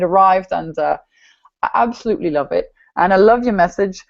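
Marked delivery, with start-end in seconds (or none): none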